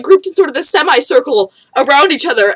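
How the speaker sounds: ordinary delivery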